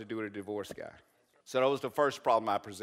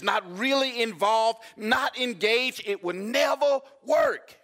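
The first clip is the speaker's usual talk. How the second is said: slow, much higher and louder shouted climax; preaching shout; 12 semitones higher, 8 dB louder, slower than usual. Acted. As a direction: drag out each phrase and shout it home. Sermon climax building.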